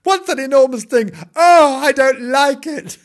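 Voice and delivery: high, loud, hammed-up panic; frightened kitten. Kitten shrinking from a motor car, frightened. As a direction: wail like a terrified little kitten. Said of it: "frightened poor Timbo".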